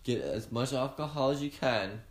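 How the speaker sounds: normal talking voice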